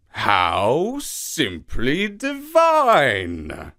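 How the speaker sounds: very slow, purring big-cat exclamation; slow dreamy leopard gush; drawn-out delight. Acted. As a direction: savour every word, enchanted leopard. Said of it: "exclaimed Asibo the leopard".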